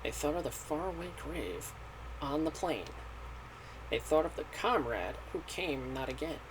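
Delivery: soft, measured verse recital; solemn poem reading. Acted. Reading a sad epigraph to viewers, solemn and hushed. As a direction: read it quietly like a toast to the dead. Reads the opening epigraph aloud.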